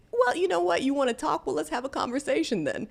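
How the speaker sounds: high, daring, half-mocking; imitating her own defiant thought; sassy inner voice. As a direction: tease a bold challenge. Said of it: "I felt like".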